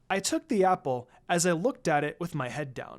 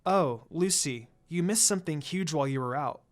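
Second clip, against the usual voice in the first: usual speaking voice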